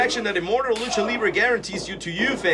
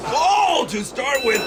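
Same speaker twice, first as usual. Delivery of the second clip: excited; 7 semitones higher, slower than usual. Real, not acted. Commentator reacts to big move.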